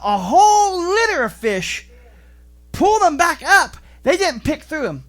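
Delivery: high, loud sermon drive; fiery preaching. Acted. Pastor correcting the fishing picture, loud fervent preaching. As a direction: preach it hard to the congregation.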